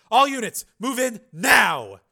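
loud, urgent military order; shout, stretching the final word; shouted command. Acted. Commander sends all units in, shouting. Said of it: "Volkirk shouted into his helmet mic".

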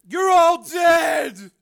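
high, loud, drawn-out yell; frenzied shout. A narrator's reading. Crazed brother at invisible enemies, frenzied shout. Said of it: "he shouted".

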